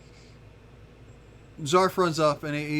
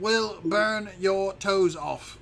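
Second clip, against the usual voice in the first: slow, higher-pitched dragon menace; slightly raised, grandiose voice; mock dragon threat; 5 semitones higher, 7 dB louder, slower than usual. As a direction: draw out the threat pompously.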